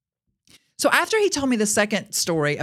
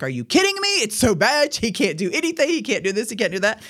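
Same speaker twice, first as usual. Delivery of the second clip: incredulous wife; 3 semitones higher than usual. Retold or imitated. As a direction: play the wife who can't believe him. Wife protesting husband's back pain.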